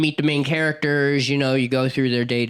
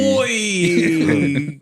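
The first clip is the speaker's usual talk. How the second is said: mock Aussie oi; 4 semitones higher, 4 dB louder than usual. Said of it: "they're Australian".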